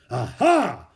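loud, jolly exclamation; booming, jolly. A narrator's reading. Monk catches a boy climbing over the edge, booming jolly. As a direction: boom it out merrily. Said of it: "boomed a deep, jolly voice".

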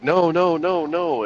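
quick high rising no's, getting flustered; flustered denial. Retold or imitated. Sergeant flustered, hastily denying crack pipe accusation. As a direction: deny it fast and high, caught off guard. Sergeant denies, gets defensive.

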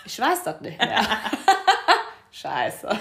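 laughing through the words, embarrassed. Real, not acted. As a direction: laugh it off, caught out. Laughs while remembering turning eighteen. (verlegen lachend)

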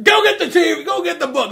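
high, loud impatient bark; exasperated yell. Retold or imitated. Gary Oldman's villain yelling at his henchman, exasperated high shout. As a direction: snap the order at a dim grunt.

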